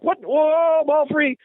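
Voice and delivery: high, loud excited shout; excited yelp. Retold or imitated. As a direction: leap up yelling at the loose ball. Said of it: "I was like".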